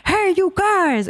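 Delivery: high, drawn-out mock holler; retold scream. Herb owner calls out at the pickers, imitated yell. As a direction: imitate a neighbour hollering from his door. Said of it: "He screamed at us".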